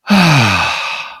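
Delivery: long, loud relieved exhale; sigh of relief. A narrator's reading. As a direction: let out a big sigh of relief. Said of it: "he sighed with relief".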